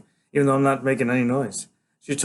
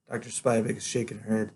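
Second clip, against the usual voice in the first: ordinary delivery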